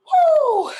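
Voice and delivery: loud high whew to pull herself together; steadying exhale. Real, not acted. Bracing herself before retelling, sharp whew. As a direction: blow out the emotion. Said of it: "I'm about to get emotional".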